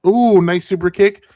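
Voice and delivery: lively ringside play-by-play; wrestling commentator. Real, not acted. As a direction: call the moves as they happen. Live play-by-play of match.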